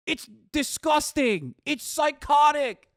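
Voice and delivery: slow, loud and high, each word hammered; slow disgusted verdict. Real, not acted. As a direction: spit out the disgust slowly. Disgust at fan videos.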